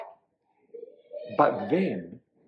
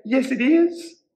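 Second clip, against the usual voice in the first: high, louder cat's reply; little cat talk; 11 semitones higher, 5 dB louder than usual. A narrator's reading. Cat agrees with the witch, high-voiced. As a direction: translate the meow in a high cat voice. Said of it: "which meant".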